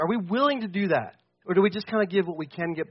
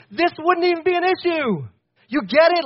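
rising to a near yell; fired-up preaching; 9 semitones higher, 3 dB louder than usual. Real, not acted. Pastor nearly yelling at the congregation, fired up. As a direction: preach it like you're about to explode. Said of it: "this guy's yelling".

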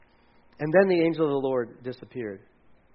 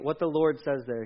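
ordinary delivery

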